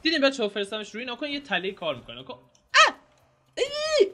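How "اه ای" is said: sharp high yelps of pain. Real, not acted. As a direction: cry out as if hit hard. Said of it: "آی، درد داشت".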